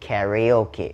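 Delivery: "karaoke" American style, KAE-ree-OH-kee; American karaoke pronunciation. Shown as a demonstration. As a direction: front AE vowel at the start of "karaoke", as in America. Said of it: "ถ้าเป็นอเมริกาจะออกเป็นสระแอ".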